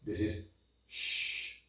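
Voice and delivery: "sh" as a long soft hiss, no voice; teacher demonstrating the sh sound. Shown as a demonstration. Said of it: "this and these two are pretty similar".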